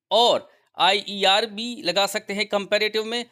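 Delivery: normal talking voice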